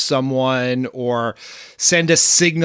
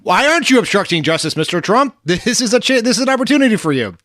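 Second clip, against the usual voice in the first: higher-pitched mock urging; eager TV host egging on; 8 semitones higher, 4 dB louder than usual. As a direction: lightly imitate a host coaxing the president. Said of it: "they did try to encourage Donald Trump".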